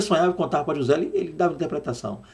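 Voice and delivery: ordinary talk, nothing marked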